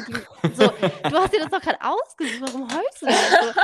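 loud laughing retelling of his question; can't get through his words without laughing; laughing quote. Retold or imitated. Voicing her boyfriend's puzzled question, laughing loudly.

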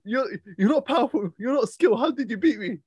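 high mock voice, disbelieving; high mocking imitation of a baffled boxer; mimicking a boxer. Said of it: "he goes".

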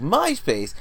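high-pitched retold incredulous echo; imitated amused reaction. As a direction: pitch it up like amused students repeating it. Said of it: "they would laugh and be like".

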